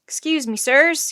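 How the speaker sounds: moderate shout for attention. A narrator's reading. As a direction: call politely over the noise. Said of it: "he shouted".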